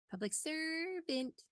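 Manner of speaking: high, slow, drawn-out animal call; frauditor mating call. Retold or imitated. Narrator mocks the frauditor's call as a mating cry. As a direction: bellow it like a bird's mating cry. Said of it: "listen to what it sounds like".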